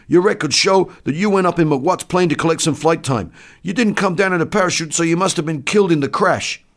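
high, loud soldier's voice; enlisted man explaining. Acted. Enlisted man explaining the crash to doctor, high and loud. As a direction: explain loudly at high pitch.

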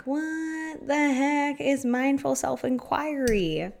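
high, drawn-out puzzled question; mock bafflement. Podcaster voices a listener puzzled by the topic, mock bafflement. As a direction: stretch it out slowly like a baffled listener.

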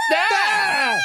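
shrill startled shriek; sudden scream. Acted. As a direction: scream out of nowhere. Surprise scream audition.

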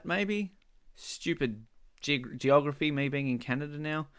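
ordinary talk

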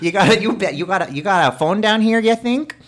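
high, loud mock Minnesota; Minnesota accent. Riffing on airport mechanics, exaggerated Minnesota accent. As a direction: play up a chirpy Minnesotan caricature. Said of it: "That's Minnesota".

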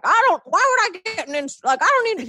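high, put-on grumpy dad voice; imitation of dad. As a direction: balk in a caricatured father's voice. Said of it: "he doesn't talk like that".

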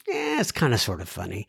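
low, soft half-amused shrug; unimpressed. Acted. Host voicing someone who types LOL without laughing, unimpressed. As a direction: toss it off quietly, barely amused.

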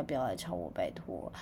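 pleading whisper. Acted. Begging to be left alone.

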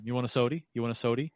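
soda pronounced "sody", ending in ee; grandma's sody. Retold or imitated. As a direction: quietly quote grandma offering a "sody". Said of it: "my grandma used to call".

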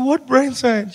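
can't believe what she said; incredulous kid. Retold or imitated. Young Trevor incredulous.

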